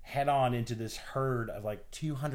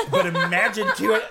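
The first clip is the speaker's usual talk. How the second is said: laughing loudly; 7 semitones higher, 11 dB louder than usual. Real, not acted. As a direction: bursts out laughing. Laughing after bison sound.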